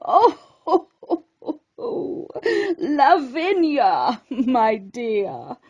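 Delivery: slow, cracked voice with a chuckle; old lobster chuckling her name to Snippy; laughing old lobster. Acted. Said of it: "The words rang with laughter".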